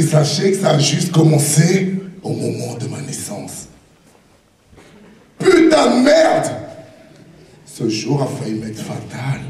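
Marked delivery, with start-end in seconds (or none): 5.4-6.5 high loud outburst; shouting. Acted. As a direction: blurt it out loud. Sudden outburst.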